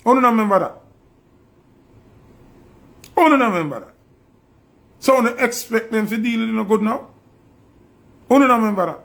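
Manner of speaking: angrily scolding the public; heated rant. Real, not acted. Ranting at Jamaicans about the government, heated and loud.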